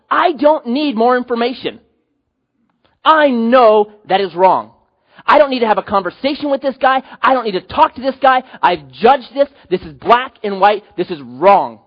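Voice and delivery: loud insistence that brooks no debate; emphatic judgment. Acted. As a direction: lay down the verdict, punching key words. Voices Paul's firm judgment.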